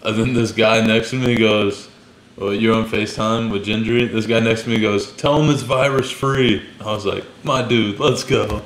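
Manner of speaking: ordinary delivery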